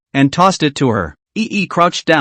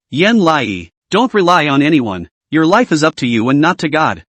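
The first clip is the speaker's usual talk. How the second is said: ordinary delivery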